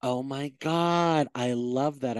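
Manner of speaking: ordinary talk, nothing marked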